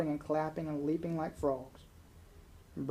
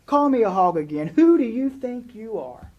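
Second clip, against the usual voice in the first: loud, high furious yell at God; 7 semitones higher, 11 dB louder than usual. Acted. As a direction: hurl it out like a challenge, loud and high. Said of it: "Then she starts yelling".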